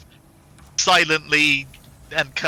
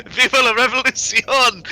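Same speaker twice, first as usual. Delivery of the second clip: loud, higher mock battle cry; revolutionary shout; 9 semitones higher, 5 dB louder than usual. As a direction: shout it like a rebel leader.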